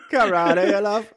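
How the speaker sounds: can't get the words out for laughing. Real, not acted. Laughing while repeating the line.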